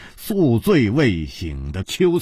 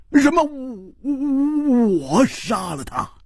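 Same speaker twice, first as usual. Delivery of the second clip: startled, stammering protest, pitched up; 惊愕辩解; 6 semitones higher than usual. Acted. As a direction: protest your innocence in disbelief. 被冤枉杀人，惊愕辩解. (又惊又急地辩解)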